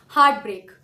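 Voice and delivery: Heart break with both words loud and strong; two strong beats, heart break; equal stress. Shown as a demonstration. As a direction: give heart and break the same firm weight. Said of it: "दोनों ही word equal weightage के".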